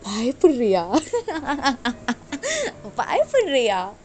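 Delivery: loud mocking question with a laugh; gleeful villain toying with a victim; menacing taunt. Killer taunts frightened captive.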